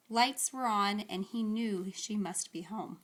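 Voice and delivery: plain reading voice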